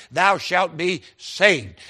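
slow build onto a drawn-out "saved"; emphasis on saved. Retold or imitated. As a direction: stretch and stress "saved" at the end. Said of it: "Every time I come to the word saved".

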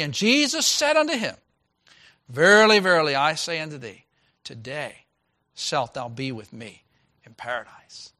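solemn proclamation easing into a hush; scripture reading. Acted. Pastor reads scripture aloud.

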